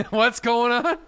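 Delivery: high, loud mock bewilderment; baffled outburst. Listener lost among Newfies, hammed-up bewilderment.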